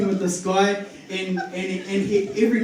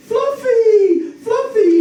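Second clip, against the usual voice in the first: high, louder wail of the name; mock wailing lady; 14 semitones higher, 6 dB louder, much slower than usual. Cat owner running out, high loud wail. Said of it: "she goes".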